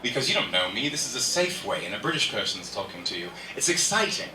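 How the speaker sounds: put-on British accent; casual chat in a British accent. Shown as a demonstration. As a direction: do a British impression. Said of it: "jumping straight into a British impression".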